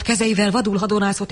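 plain reading voice

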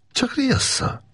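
tossed off high and casual; offhand, dismissive. Acted. Gábor brushing off the boss's threat, offhand. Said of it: "vetette oda Makos Gábor foghegyről".

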